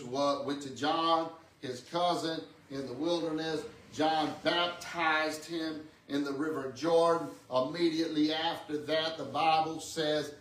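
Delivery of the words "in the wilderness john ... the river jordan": normal talking voice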